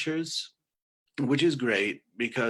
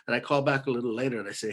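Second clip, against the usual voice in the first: normal talking voice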